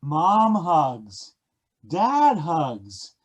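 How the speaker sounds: ordinary delivery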